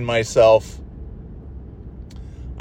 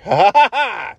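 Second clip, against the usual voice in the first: mock DJ laugh; 8 semitones higher, 3 dB louder than usual. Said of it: "It's so funny".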